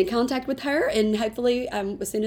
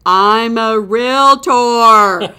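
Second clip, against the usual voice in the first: slow, loud, drawn-out declaration; mock announcement; 12 dB louder, much slower than usual. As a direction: announce it like a billboard. Mock-formal self-advertisement.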